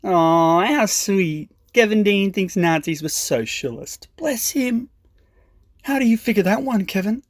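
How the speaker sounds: mock patronising Australian voice, dropping to a whispered "Bless him"; mocking a leftist in a condescending Australian accent. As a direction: talk down to Kevin like a sneering Aussie. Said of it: "pull the full condescending Australian attitude".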